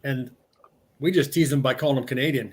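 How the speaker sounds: normal talking voice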